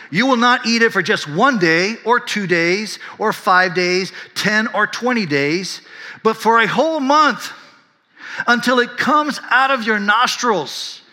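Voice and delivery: high, loud and slow warning; booming God voice. Retold or imitated. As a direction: thunder it out like an angry God. God's warning quoted, building.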